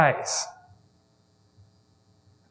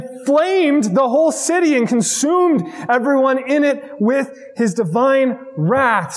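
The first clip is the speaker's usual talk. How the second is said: higher, shouted and slow; prophet's rebuke; 5 semitones higher than usual. Acted. Preacher voices Ezekiel rebuking Sodom, slow high shout. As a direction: thunder it like an angry prophet.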